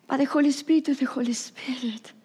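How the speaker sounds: quick emphatic whisper. Real, not acted. Pastor whispers about the Spirit, fast and hushed.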